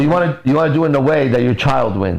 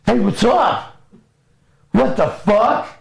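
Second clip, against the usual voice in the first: high-pitched startled dog greeting; excited dog; 10 semitones higher, slower than usual. Acted. Sleeping dog greets owner coming home, excited. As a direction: jump awake like an overexcited dog. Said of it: "your dog is dead asleep".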